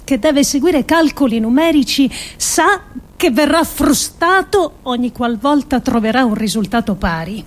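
ordinary talk

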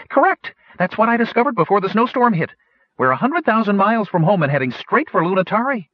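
very high, quick, eager gnome voice; excited gnome. Acted. Citer announces where the ship is heading, very high and eager. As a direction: blurt out the discovery like a thrilled little inventor.

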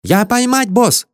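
very high, gleeful foreign helper; jubilant. A narrator's reading. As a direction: crow with triumph in a piping voice. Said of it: "ликовал Кули". (ликующе, очень высоким голосом)

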